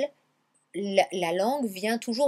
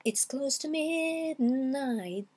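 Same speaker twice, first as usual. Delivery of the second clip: MIDNIGHT stretched slowly, higher, MID held long; drawn-out MID; 5 semitones higher, much slower than usual. Shown as a demonstration. Teacher imitates how the song goes, MID lengthened. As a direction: draw out MID and leave NIGHT hanging. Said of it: "il allonge le mid".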